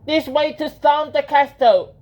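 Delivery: high, loud rallying call; shouting. A narrator's reading. Team of mice swinging on a rope, high shout.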